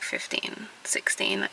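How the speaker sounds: a soft, private aside whispered to viewers; whispered confession. Real, not acted. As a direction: drop to a bare whisper on the ages. Confessing antidepressants at fifteen.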